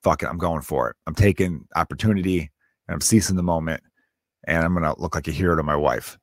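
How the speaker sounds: low-pitched inner monologue; imitated resolved husband. Husband deciding to be his wife's hero, low mock resolve. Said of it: "he was just like".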